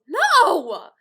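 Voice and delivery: loud, high, drawn shout; exasperated big-brother shout; emphatic refusal. Retold or imitated. Brother refusing to let sister play, exasperated shout.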